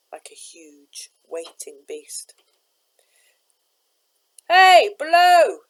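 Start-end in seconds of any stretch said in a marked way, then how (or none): 4.5-5.6 slow, high, loud call from above. A narrator's reading. Stranger calling down to Jim on the lighter, loud call. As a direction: hail someone far below. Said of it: "a voice called down to him".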